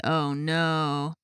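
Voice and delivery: ordinary delivery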